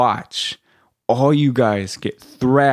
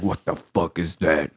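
low, quieter croaked mimicry; hoarse frog voice; 7 semitones lower, 5 dB quieter than usual. Hoarse instructor pointing at recruit's hair, frog voice. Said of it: "got that frog voice".

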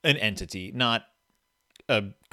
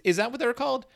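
normal talking voice

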